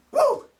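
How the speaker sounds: high, loud mock cheer; sarcastic whoop. Mocking the NFL's tough schedule with a loud fake whoop.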